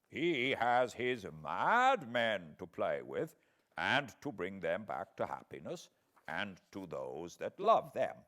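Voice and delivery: put-on gentle elderly scholar, taking his time; old foreign professor. A narrator's reading.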